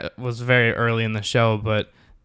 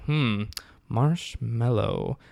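very slow, considered word; Captain Holt deadpan; 5 dB quieter, much slower than usual. Retold or imitated. Captain ponders the marshmallow handed to him, deadpan. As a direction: draw the word out with mock solemnity.

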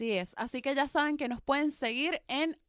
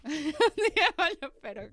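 laughing; 4 semitones higher than usual. Real, not acted. Repeats partner's joke laughing.

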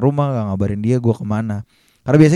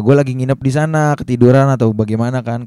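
ordinary talk

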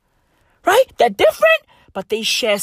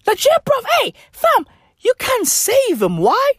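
high excited; 14 semitones higher than usual. Real, not acted. High mocking voice.

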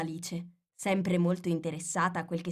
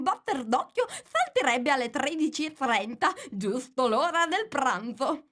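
higher, know-it-all Hatter explaining time; Mad Hatter, lecturing; 6 semitones higher, 3 dB louder than usual. Acted. Hatter explains to Alice how to charm time, higher and lecturing. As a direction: play the fussy Hatter spelling it out.

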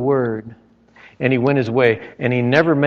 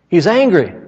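higher and louder the second time; loud repeat; 6 semitones higher, 5 dB louder than usual. Shown as a demonstration. Preacher to the church, emphatic loud repeat. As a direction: punch it up for emphasis.

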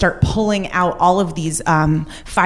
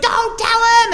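urgent warning; slower than usual. Retold or imitated. Dad urgently warning on phone.

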